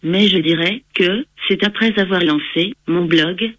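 synthetic computer voice reading text; text to speech voice. Real, not acted. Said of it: "J'utilise un logiciel de synthèse vocale".

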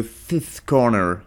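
Fifth Corner in an American accent, with an English th in Fifth. Shown as a demonstration. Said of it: "avec l'accent américain".

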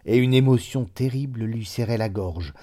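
plain reading voice

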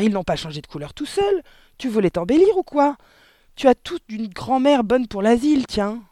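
high, loud berating wife; shouted scorn. A narrator's reading. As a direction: rail at your ridiculous husband. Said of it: "cria la mère". (invectives criées, voix aiguë)

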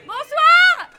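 loud, high shout. Real, not acted. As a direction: yell the greeting. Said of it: "Non, mais crie pas, c'est horrible".

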